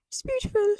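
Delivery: imitating Charlie's tiny teary voice; little British boy voice. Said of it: "with a little British voice".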